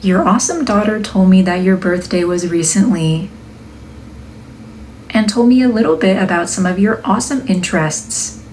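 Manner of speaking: upbeat narration for a product video; video voiceover. Acted. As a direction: read it like a cheerful product reveal. Reads the product voiceover script.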